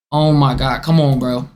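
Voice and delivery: usual speaking voice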